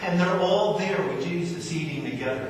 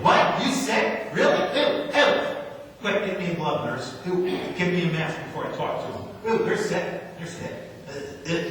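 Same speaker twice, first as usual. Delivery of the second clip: ungentle, repulsed doctor; 3 semitones higher than usual. Acted. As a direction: recoil from the patient in mock alarm. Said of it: "who is not gentle when you walk in".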